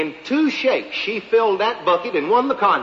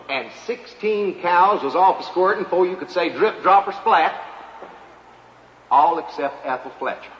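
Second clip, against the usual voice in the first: ordinary delivery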